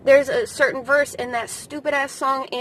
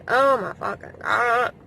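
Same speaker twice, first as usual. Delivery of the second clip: choked-up laugh; 4 dB louder, slower than usual. Real, not acted. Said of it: "I'm getting emotional".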